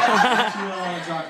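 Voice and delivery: laughing through the words; can't keep a straight face. Real, not acted. Comic coming out of his joke to the crowd, laughing. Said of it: "laughing after joke".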